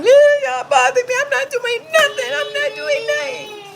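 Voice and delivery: high, loud mock sob; fake crying. Fake crying to the police, high loud whine. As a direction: wail it with fake tears. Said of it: "stop fake crying".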